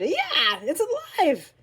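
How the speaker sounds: loud, high scream of comic terror; high-pitched horrified yell; man's scream. A narrator's reading. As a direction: scream in panicked shock. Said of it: "The man screamed".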